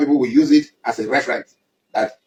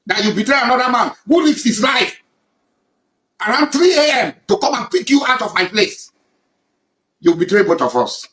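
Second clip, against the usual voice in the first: furious accusation; 7 semitones higher than usual. Real, not acted. Chief shouting at a former ally who betrayed him. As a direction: shout it out, wounded and enraged.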